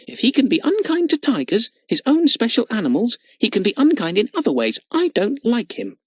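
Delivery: put-on high girl's voice, dug in and sulky; a small girl refusing to budge; stubborn little girl. Lotta tells Jimmy she dislikes Frick, stubborn high girl voice.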